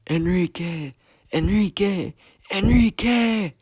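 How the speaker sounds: high, loud, climbing cries of grief; anguished yell. A narrator's reading. Gio cries out over dying Enrique, anguished yell. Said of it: "starts to cry and yells".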